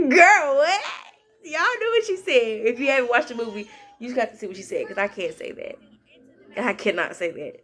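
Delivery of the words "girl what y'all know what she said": high disbelieving laugh-cry. Real, not acted. Reacting to a movie scene.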